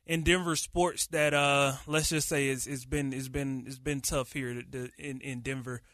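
ordinary talk

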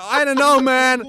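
high, loud imitated hesitation; reluctant frat boy. Frat-boy wrestler wavering, high mock reluctance. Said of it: "And he's going".

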